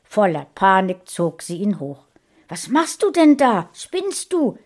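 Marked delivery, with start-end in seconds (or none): 2.5-4.6 much higher and louder, panicked scolding; a mother finds her son underwater and shouts at him in panic; shouting mother. A narrator's reading. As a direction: yell at the boy in fright. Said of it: "schrie sie ihn an".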